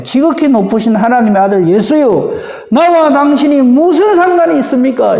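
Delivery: mocking, grudging acknowledgement; sneering. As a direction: say it with a sarcastic edge, resentful. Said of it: "비아냥거린다 그래야 될까요?". (비아냥거리며 못마땅하게)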